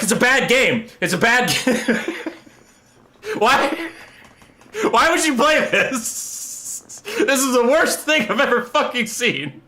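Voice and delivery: high whining complaint breaking into laughter; distressed protest. Retold or imitated. Acted distressed verdict to friends.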